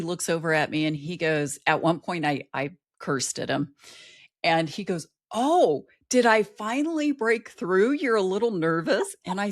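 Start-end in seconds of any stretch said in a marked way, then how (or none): 5.3-9.1 teasing instructor. Retold or imitated. Instructor teasing voiced in story.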